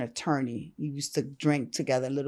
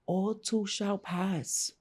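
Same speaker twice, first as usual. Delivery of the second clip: slow comforting advice; 5 semitones higher, much slower than usual. Retold or imitated. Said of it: "He was like".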